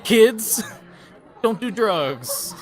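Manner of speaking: high, loud, then slow with laughter; mock PSA Punisher. Punisher lecturing daycare kids, mock loud warning through laughter. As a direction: bark a warning to children, cracking up. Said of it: "He busts into the daycare".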